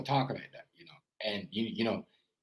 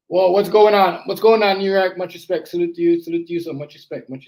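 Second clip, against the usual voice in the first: loud, higher-pitched shout-out; calling out greetings; 6 semitones higher, 7 dB louder than usual. Real, not acted. Host hailing arrivals from New York, calling out greetings. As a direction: hail them loudly, pitched up. Hailing viewers joining the stream.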